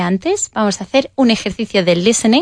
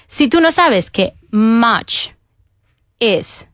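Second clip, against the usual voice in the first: ordinary talk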